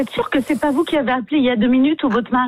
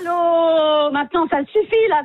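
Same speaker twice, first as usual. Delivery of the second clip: exasperated, higher-pitched protest; fed up; 5 semitones higher than usual. Real, not acted. Receptionist fed up with prank calls.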